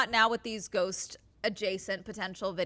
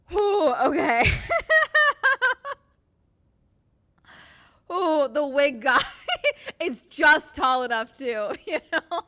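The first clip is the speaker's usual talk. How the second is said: slow loud high words broken by laughter and tears; crying with laughter; 5 semitones higher, 6 dB louder, slower than usual. Real, not acted. Streamer cracking up at the wig guy in the video, tearful laughter. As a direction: laugh till you cry. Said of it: "I'm crying".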